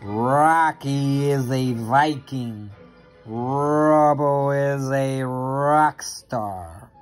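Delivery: ordinary delivery, nothing marked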